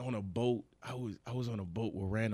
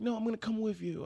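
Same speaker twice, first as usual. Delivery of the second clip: put-on high girlfriend voice; 10 semitones higher than usual.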